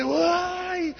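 high, stretched "ay"; drawn-out yelp. Acted. José dodging the woman's grab. (un "ay" agudo y alargado)

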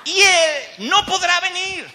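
loud, high, drawn-out cry; emphatic declaration. Acted. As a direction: proclaim it slowly at the top of your voice. Climax of servant's emphatic refusal. (grito enfático, lento y agudo)

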